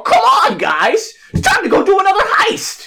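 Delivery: high, loud rallying cry; mock pep-talk shout; pumping up the crew. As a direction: yell it like a hammed-up hype man. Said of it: "He's gonna pump you up".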